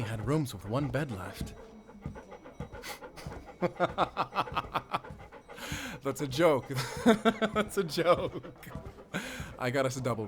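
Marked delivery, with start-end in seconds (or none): 1.6-6.0 high breathy giggle; teasing high giggle; laughing. Acted. Hunter laughs at own joke. 6.0-6.7 said through laughter; teasing, laughing confession. Acted. Hunter teasing about one bed. 6.7-7.5 high bright burst of laughter; teasing high laugh. Acted. Hunter laughs at own joke. 7.5-8.6 slow, high, laughing words; teasing, giggling admission. Acted. Hunter teasing about one bed.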